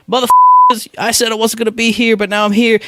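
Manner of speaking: crash the stage screaming the reveal; Hideo Kojima unmasked. Retold or imitated. Game director unmasking himself at the awards, shrieking mock announcement. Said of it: "and he goes".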